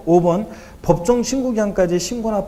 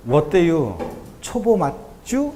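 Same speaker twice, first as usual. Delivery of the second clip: slow, drawn-out Chungcheong drawl on 맞쭈; 충청도 사투리로 느긋하게 읽듯이; Chungcheong dialect; much slower than usual. Retold or imitated. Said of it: "충청도 말이 짧긴 짧더라고요". (충청도 사투리로 느릿하게)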